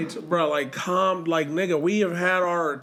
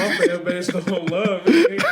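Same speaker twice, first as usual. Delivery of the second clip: slow, louder quote through laughter; laughing mock quote; 3 semitones higher, 5 dB louder, slower than usual. Fan mimics his hurt quarterback for friends, laughing.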